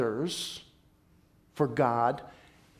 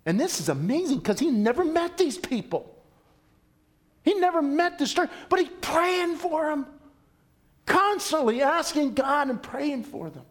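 amazed preaching; 10 semitones higher than usual. Real, not acted. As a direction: lift the voice in wonder at it.

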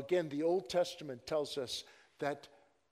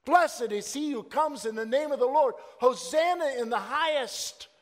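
loud, high joyful acclamation; crowd shouting; 8 semitones higher, 7 dB louder than usual. Retold or imitated. Preacher quoting the crowd welcoming the king, loud shout. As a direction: proclaim it like a crowd at a parade. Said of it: "when they were shouting".

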